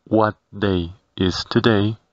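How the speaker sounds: "What day is today" slowly, word by word; small pauses between words in "What day is today"; slow model phrase. Shown as a demonstration. Teacher modelling the question in English for learners, slow and separated. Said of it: "que en inglés se traduce".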